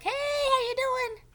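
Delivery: high, loud, slow cheery hello; Mister Mole's greeting. Retold or imitated. Mister Mole greeting Franklin, high and cheery. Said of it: "Mister Mole's like".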